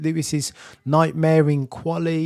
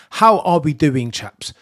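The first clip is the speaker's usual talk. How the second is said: loud, punchy greeting to the co-hosts; hyped host welcome; 3 semitones higher, 8 dB louder, slower than usual. Acted. Host opens the podcast to his co-hosts, hyped and loud. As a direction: throw it out big, like a show opener.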